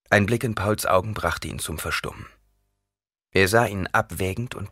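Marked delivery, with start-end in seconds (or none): none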